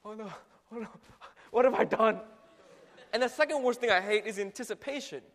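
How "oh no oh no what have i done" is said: mock panic building to a louder regret; acted panic of someone strapped in; freaking out. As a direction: gasp out short bursts of dread. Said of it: "like, freaking out".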